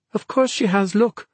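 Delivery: put-on high-pitched girl's retort; indignant girl. A narrator's reading. Naomi insists to Ben the dog is right, indignant. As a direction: answer back high and insistent.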